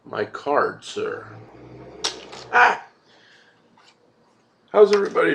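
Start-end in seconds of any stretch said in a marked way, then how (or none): 2.5-2.9 high, loud showy exclamation; a host's grand gasp of delight; a big flourish of an "Ah"; theatrical "Ah!". Acted. Theatrical exclamation after card.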